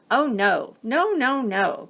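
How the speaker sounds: lightly voiced crabs protesting; tiny crabs crying out. Crabs admit they don't know the way, flustered high voices. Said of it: "cried the tiny crabs".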